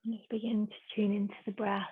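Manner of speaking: soft, hushed yoga instruction with long pauses; unhurried, softly spoken relaxation guidance; guided meditation. Real, not acted.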